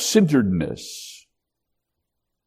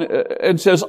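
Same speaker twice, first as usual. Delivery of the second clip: normal talking voice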